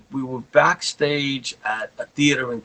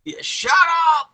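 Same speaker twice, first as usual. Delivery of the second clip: mock yell; 4 dB louder than usual. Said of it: "he yells at me".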